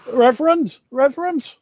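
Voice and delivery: high calling out to the vicar. Acted. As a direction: call out high to get his attention.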